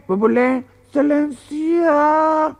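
high, slow, drawn-out excelencia; fawning pilot. Acted. Pilot answers the boss in a high, fawning drawl. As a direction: grovel with a stretched excelencia.

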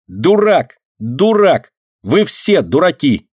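high-pitched angry yells; shouted taunts. A narrator's reading. Girl hanging on fence, shouting insults. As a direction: hurl each insult separately. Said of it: "кричала, повиснув на заборе".

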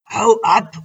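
loud, curt badger snarl; gruff grunt. Badger shoos the elf from his tree, loud grunt. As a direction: bark him off like a fat badger. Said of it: "grunzte der nur".

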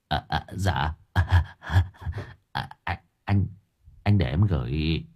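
halting, nervous stammer, low and soft; timid young man. A narrator's reading. Young man timidly offering money to old gangster, nervous stammer. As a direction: stammer meekly with nervous breathy chuckles. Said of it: "nuốt nước bọt e dè".

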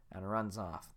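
ordinary talk, nothing marked

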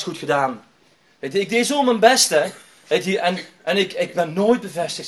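retold pent-up outburst. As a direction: act out a hurt son listing his efforts. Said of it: "toen kwam het allemaal eruit".

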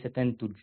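تنتج as "tantuj", with a then u; wrong form demo. Shown as a demonstration. Teacher naming what not to say, تنتج mispronounced tantuj. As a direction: say تنتج wrongly as tan-tuj. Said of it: "وليس".

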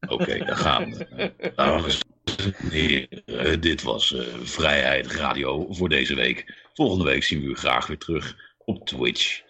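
low, slow velvety announcer voice; sexy radio voice. Pretend. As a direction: seductive late-night radio sign-off. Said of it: "met jouw, eh, sexy stem".

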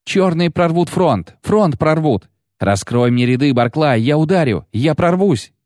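high, urgent woman's voice; shouting over the roar. A narrator's reading. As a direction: plead to be let through, voice pitched high. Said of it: "перекричала рёв сладкая ветреница".

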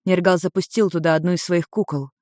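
usual speaking voice

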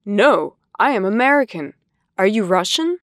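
usual speaking voice

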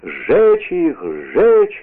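a narrator's contained roar; roaring crowd. A narrator's reading. Crowd demanding the men be burned, mild roar. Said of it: "ревела толпа".

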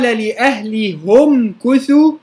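Hamza's reading; damma reading. Shown as a demonstration. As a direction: say لأهلهم as li-ahlihumu. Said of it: "قال".

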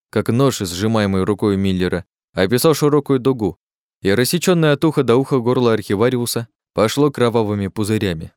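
plain reading voice

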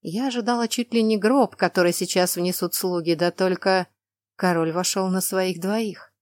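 ordinary delivery

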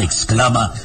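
usual speaking voice

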